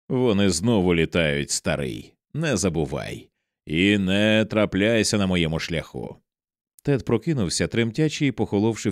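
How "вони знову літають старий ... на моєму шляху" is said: plain reading voice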